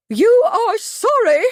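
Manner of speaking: soaring high, slow operatic outrage; voice lifted, outraged. A narrator's reading. Miss Truebody shrieks at Bradford in soaring disbelief. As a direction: rise up like a soprano tuning up. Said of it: "her voice lifted".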